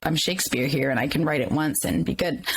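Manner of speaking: ordinary talk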